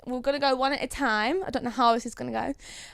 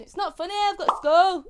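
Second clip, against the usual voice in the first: a broad Yorkshire take on the meme line; Yorkshire accent; 6 semitones higher than usual. Shown as a demonstration. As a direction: say it in your thickest Yorkshire. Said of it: "It's the Yorkshire accent".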